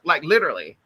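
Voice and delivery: ordinary delivery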